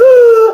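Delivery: big, high, theatrical gasp; loud gasp. A narrator's reading. Grandma doused with water, loud gasp. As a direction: gasp sharply and noisily. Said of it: "She gasped".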